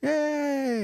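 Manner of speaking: high squeal of a cheer; mock cheer. Grandma cheering for the Sooners, high playful squeal. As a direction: imitate grandma cheering yay. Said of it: "She's like".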